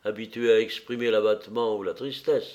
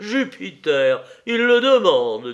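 much louder and higher, exasperated; indignant outburst; 8 semitones higher, 9 dB louder, slower than usual. A narrator's reading. The eunuch raises his arms, outraged loud cry. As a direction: throw it up to the heavens in outrage. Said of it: "levant les deux bras au ciel".

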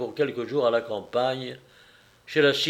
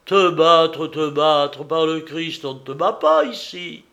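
loud, affronted protest; indignant father; 3 semitones higher, 6 dB louder than usual. Acted. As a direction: protest hotly at the very idea. Acted father's indignant retort.

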